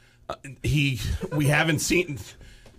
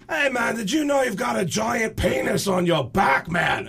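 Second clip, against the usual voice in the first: mock [name]; 8 semitones higher than usual. [name] voiced in story.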